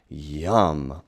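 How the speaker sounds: ordinary delivery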